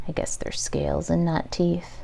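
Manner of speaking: soft unvoiced correction to herself; whispered aside. Real, not acted.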